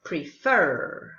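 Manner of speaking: prefer with the final er fully pronounced as an r; American er. Shown as a demonstration. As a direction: say prefer with a clear American r at the end. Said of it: "you will pronounce the er".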